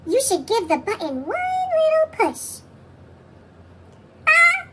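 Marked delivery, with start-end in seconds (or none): 4.3-4.7 high, loud startled yelp; startled cry. Acted. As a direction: yelp in shock. Larry turns yellow.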